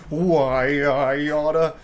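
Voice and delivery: slow, put-on tough-guy grumble; cartoon threat. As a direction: wind up slowly like an old slapstick bully. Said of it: "he was charging up one of these".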